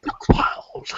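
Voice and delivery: dramatic whispered name. Acted. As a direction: breathe it conspiratorially. Adding the character's name.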